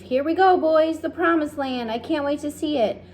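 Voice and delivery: high loud cheering; excited shouts. A narrator's reading. The company cheers climbing the ladder, excited shouts. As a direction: cheer as they set off. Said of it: "shouts of".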